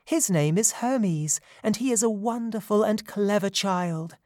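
put-on high, light fairy voice, proud of the child; gentle mountain fairy. Nurse Selene tells the satyrs about baby Hermes, light and high. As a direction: speak as a kind nurse proud of her charge.

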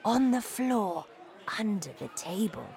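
hushed, breathy whisper. A narrator's reading. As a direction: whisper so nobody hears. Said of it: "My grandmother whispered to me".

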